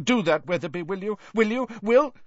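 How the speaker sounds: frantic, rising deranged official; mounting agitation. A narrator's reading. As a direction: pitch climbing with each repeat. Deranged official's orders climb, get stuck.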